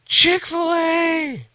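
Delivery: high, drawn-out squeal of delight; excited child squeal. Retold or imitated. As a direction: stretch it out like a thrilled kid. Daughter's excited echo.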